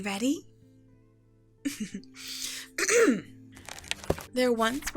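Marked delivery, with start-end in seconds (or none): 1.6-3.3 theatrical throat clear before reading; pointed little "ahem"; ceremonial throat-clearing. Acted. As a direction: clear the throat to begin a story. Preparing to read the story.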